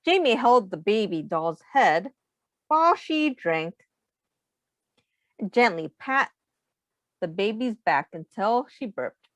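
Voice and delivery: ordinary talk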